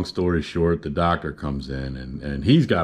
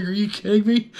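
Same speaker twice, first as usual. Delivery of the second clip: high, laughing can't-believe-it; laughing disbelief; 4 dB louder than usual. Retold or imitated. Answering the doctor, high laughing disbelief. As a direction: crack up while asking it. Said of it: "I was like".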